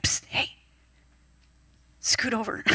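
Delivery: soft secret whisper, no voice; hushed voice of a gentle inner prompt; whispered nudge. Retold or imitated.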